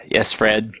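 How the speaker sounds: ordinary delivery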